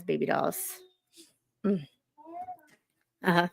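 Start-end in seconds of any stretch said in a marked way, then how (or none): none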